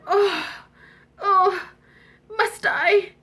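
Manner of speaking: a pleading, high little moan; reluctant princess. Acted. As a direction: princess dreading the frog on her pillow, whining. Princess dreads sharing pillow.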